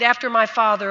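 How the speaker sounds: normal talking voice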